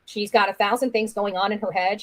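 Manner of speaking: ordinary delivery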